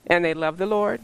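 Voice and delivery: ordinary delivery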